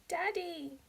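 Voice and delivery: a high, crying call to her dad; little girl wailing for her father; wailing child. A narrator's reading. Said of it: "she wailed".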